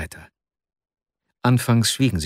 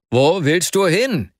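raised, higher, drawn-out challenge; narrated shout, slow and high; shouting at someone; 7 semitones higher, 4 dB louder, slower than usual. A narrator's reading. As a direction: call out sharply to stop a friend running off. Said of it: "schrien Arkadi Iwanowitsch an".

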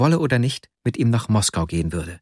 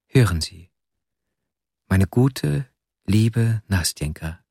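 fighting back tears; slower than usual. A narrator's reading. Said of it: "wie ich mit den Tränen kämpfend".

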